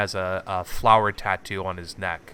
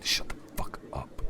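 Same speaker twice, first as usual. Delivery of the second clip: hissed whisper, vicious; whispered snap; 7 dB quieter than usual. Acted. Boss hissing at his underling, whispered snap. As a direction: whisper it like a blade. NPC snaps at henchman.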